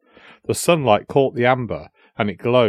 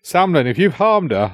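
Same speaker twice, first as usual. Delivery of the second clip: higher, agitated threat, only partly shouted; uncontrolled shout; 6 semitones higher than usual. A narrator's reading. Brother threatening his sister's captor, restrained angry shout. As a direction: threaten him, losing some control. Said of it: "I shouted, unable to control myself".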